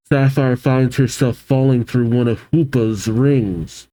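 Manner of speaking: normal talking voice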